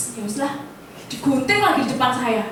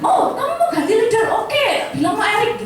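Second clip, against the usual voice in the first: Roy challenged; 8 semitones higher than usual. Retold or imitated. Roy answers the threat.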